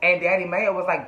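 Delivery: ordinary talk, nothing marked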